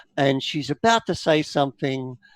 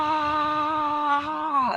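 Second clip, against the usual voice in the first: mild anguished wail; 11 semitones higher, 4 dB quieter than usual. Acted. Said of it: "falls to her knees and she goes".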